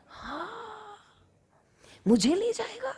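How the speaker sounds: high sarcastic exclaim; mock indignation. Yashoda scoffing at Kanha's invitation to steal, high and mocking. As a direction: scoff at the idea playfully. Mother mocks Kanha's suggestion.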